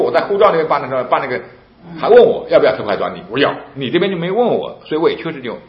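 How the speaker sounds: loud, high-pitched arguing back at the clerk; heated retold protest. 向公安据理力争，声音拔高.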